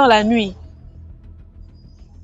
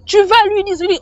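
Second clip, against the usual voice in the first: high, commanding "Tu vas lui dire"; imperious order; 7 semitones higher than usual. Retold or imitated. Dream figure orders her to warn, imperious. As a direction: bark the instruction. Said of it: "c'est avec un ton impératif".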